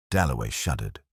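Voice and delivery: ordinary delivery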